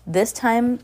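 plain reading voice

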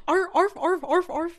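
high, loud yappy dog barks; yappy chihuahua voice. Retold or imitated. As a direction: yap like a tiny dog. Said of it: "the dog in the background".

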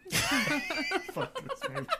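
ordinary delivery

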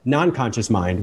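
normal talking voice

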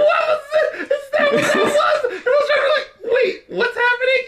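high, laughing confused outburst; laughing disbelief. Real, not acted. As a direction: squeal through laughter.

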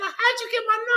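high incredulous. Retold or imitated.

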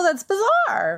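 can't believe it; shocked disbelief. Real, not acted. Co-host hearing there were no local consultants, shocked squeal.

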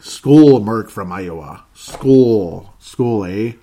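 loud, drawn-out Canadian cheer; mock Canadian accent. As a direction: tease him with a booming Canadian "eh". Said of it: "I'm just kidding you".

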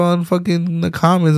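ordinary talk, nothing marked

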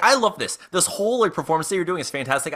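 high-pitched, delighted fan; gushing praise. Acted. Performer praises the gnome's act, high gushing delight. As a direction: lift the pitch and gush, quickening. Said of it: "so I stand up, I'm like".

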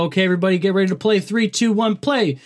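countdown announcer. Shown as a demonstration. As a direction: hype up the start. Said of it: "like, go".

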